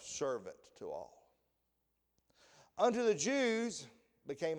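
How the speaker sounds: scripture reading. Acted. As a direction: read the verses out solemnly. Reads scripture aloud.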